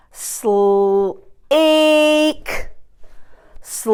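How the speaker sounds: sleek drawn out on the ee; stretched sleek; roller coaster word. Shown as a demonstration. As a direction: hold the ee in sleek. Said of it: "That was the E sound".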